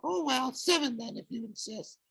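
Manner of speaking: high falsetto fading soft; coy falsetto. Acted. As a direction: concede sweetly in falsetto. Grover falsetto conceding.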